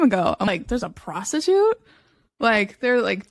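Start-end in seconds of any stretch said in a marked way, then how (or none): 0.7-1.8 higher, delighted shock; mock-astonished rising question; scandalized surprise. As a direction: ask it like juicy gossip. Retold shock while reading.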